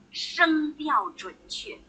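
声调准确 said very slowly, each syllable separated; slow deliberate stress. Shown as a demonstration. Teacher tells class what sounds good, spoken very slowly. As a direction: spell it out slowly for emphasis. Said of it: "什么叫好听啊".

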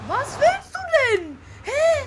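high, loud laughing question. Real, not acted. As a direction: laugh through the words. Lachende Rückfrage. (hoch und lachend gefragt)

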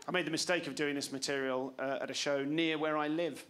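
usual speaking voice

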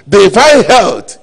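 high, loud, slow drawn-out proclamation; preaching emphasis. Acted. Pastor proclaiming to his congregation, loud and slow. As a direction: stretch out divine health like a sermon climax. Preacher stressing a key phrase.

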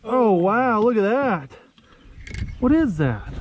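ordinary delivery

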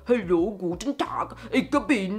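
mock Hollywood German accent, lightly done; fake German accent. Mocking Hollywood actors with a light fake German accent. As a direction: imitate a cliché German accent. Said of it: "Hollywood actor who always pretend to be German".

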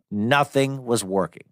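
ordinary delivery

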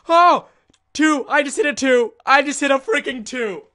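high, loud celebratory yelling; hammed-up excitement. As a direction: shout it like an unbelievable triumph. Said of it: "I'm over here like".

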